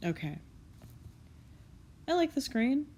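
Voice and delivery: normal talking voice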